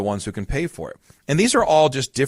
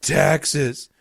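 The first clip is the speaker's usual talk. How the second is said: mildly mock-crying kid's complaint; imitated crying child; 3 semitones higher than usual. Kid losing at Monopoly, lightly imitated crying. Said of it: "He's crying. He goes".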